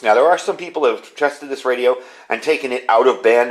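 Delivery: usual speaking voice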